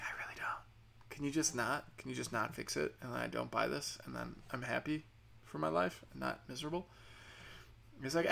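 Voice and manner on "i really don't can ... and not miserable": very soft, near-whispered begging; hushed plea. Retold or imitated. Customer begging agent not to fix it, hushed plea. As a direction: drop right down and plead under your breath.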